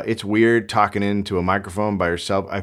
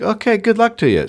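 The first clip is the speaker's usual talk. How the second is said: higher, louder mock brush-off; dismissive landlord; 11 semitones higher, 4 dB louder, faster than usual. Landlord brushing off the barking complaint, in a mock dismissive voice. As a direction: toss it off like you couldn't care less.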